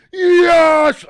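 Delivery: huge high triumphant shout; reenacted elated yell; loudest yell. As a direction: scream it out at full blast, overjoyed. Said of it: "the loudest yell that you ever did hear".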